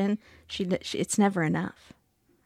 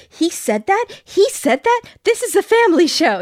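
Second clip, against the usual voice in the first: high-pitched scandalized outburst; mock horror, pitch leaping up in disbelief; horrified; 10 semitones higher, 3 dB louder than usual. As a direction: act appalled at the indecency. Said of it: "He's, like, horrified".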